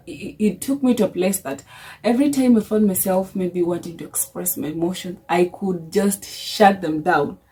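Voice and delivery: ordinary talk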